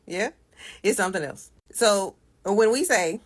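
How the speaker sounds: ordinary talk, nothing marked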